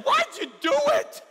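very high, loud, incredulous shout; shouted challenge. Retold or imitated. Horrified student confronting the veteran engineer, shouting. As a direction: burst out demanding an answer.

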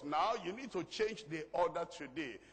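ordinary talk, nothing marked

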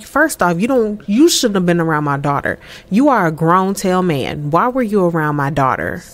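low, measured reprimand; retold stern confrontation. Dad confronting the man around his daughter, mildly stern. Father confronts older boyfriend.

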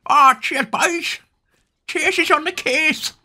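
very high cartoon puppy catchphrase; squeaky pup voice. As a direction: chirp it like a cartoon pup hero. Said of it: "if they all spoke like that?".

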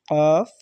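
"কফ" said deep from the throat and loud; deep qaf sound. Shown as a demonstration. Teacher showing students the deep letter, throaty কফ. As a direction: punch a throaty "কফ". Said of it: "মানে deeply উচ্চারণ হবে".

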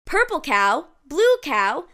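ordinary delivery, nothing marked

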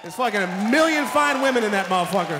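usual speaking voice

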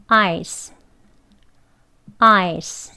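ice said slowly, carefully; word drill. Shown as a demonstration. Teacher models ice twice for learners, careful drill. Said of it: "두 번 연습합니다".

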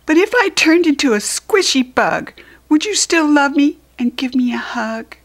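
put-on high, loud pleading child voice; small child asking. Small asking Large about love, a high pleading child voice. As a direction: ask anxiously like a little one.

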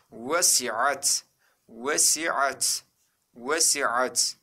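"Vesiat" with W onset, ending on bare T; model reading. Shown as a demonstration. Teacher shows students how to read it, careful model reading. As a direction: sound out "Vesiat" carefully, syllable by syllable. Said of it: "Nasıl okumamız gerekiyor?".

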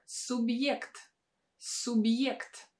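субъект with y and e after the b; y glide. Shown as a demonstration. Teacher modelling субъект, y after hard sign. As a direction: say субъект as sub-yekt, y glide clear. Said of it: "a combination of y and e".

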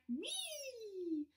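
high soft squeal of joy; Frog cries wee. Acted. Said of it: "Frog cried".

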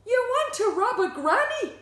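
high, loud disbelief; aghast, blurting it out as a question; loud, scandalised squawk of a question; incredulous outcry. A narrator's reading. Shocked at plan to rob granny.

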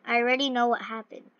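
ordinary delivery

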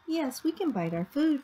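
usual speaking voice

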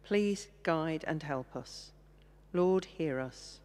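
usual speaking voice

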